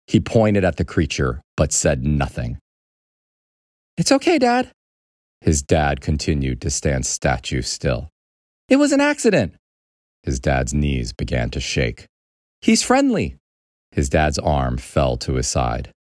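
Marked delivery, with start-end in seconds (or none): 4.0-4.7 put-on high-pitched boy soothing a parent; anxious reassurance. Boy reassuring his shocked dad, anxious. 8.7-9.5 put-on high-pitched boy excusing himself; anxious explaining. Boy explaining the exploded dryer to dad, anxious. 12.6-13.4 put-on high boy voice, not yet shouting; anxious reassurance. Boy's first try at calming frozen dad, anxious reassurance.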